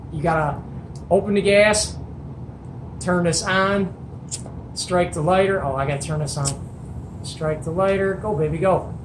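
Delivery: ordinary talk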